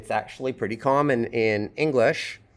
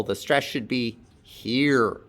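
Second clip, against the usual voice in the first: usual speaking voice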